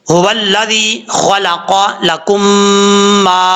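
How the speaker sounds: slow, drawn-out melodic recitation; Quran recitation. Shown as a demonstration. Teacher opening the lesson's verse, in slow recitation. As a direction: recite slowly, each sound stretched. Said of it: "सूरह अल बकरा का part number eighteen start करते हैं".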